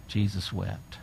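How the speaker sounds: soft and low, almost breathed; hushed reverent. Acted. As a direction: let it fall quietly, with weight. Shortest verse, hushed reading.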